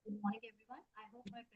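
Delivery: very faint, far-off voice; barely audible. Real, not acted. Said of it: "Ma'am, you're not audible".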